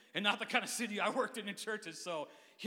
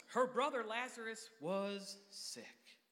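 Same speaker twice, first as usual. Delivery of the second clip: slow dramatic reading; 2 semitones higher, much slower than usual. Acted.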